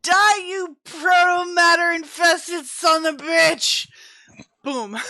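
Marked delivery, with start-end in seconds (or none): 0.0-3.9 roaring, slow battle curse; battle cry. Acted. Player's character shooting the monster, roaring. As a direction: bellow it at the monster.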